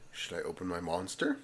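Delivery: ordinary delivery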